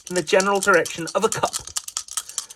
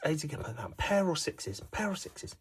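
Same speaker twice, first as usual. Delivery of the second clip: hushed, tense whisper; 2 semitones lower, 13 dB quieter than usual. Acted. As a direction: whisper like a tense golf commentator.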